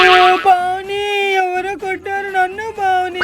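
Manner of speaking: long, stretched-out mock wail; hammed-up wailing. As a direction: call for help in a drawn-out wail. Wails to Pavani about being hit. (సాగదీస్తూ దొంగ ఏడుపు)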